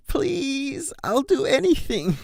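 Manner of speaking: put-on high begging kid voice; pleading. Colt on his brother's stomach, high pleading. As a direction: plead with big puppy eyes. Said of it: "Rumble pleaded".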